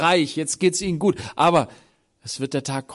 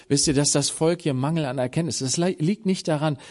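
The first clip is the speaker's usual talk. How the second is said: normal talking voice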